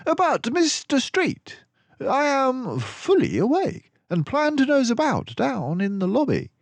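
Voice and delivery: high, loud, mannered detective voice; Basil's voice. Acted. Basil reassures gloomy Dawson on the train, high and hearty. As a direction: play the confident mouse detective.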